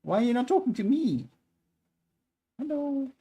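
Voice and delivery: high, whiny cat voice; voicing the cat. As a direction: speak for the cat, high and needy. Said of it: "and you go like".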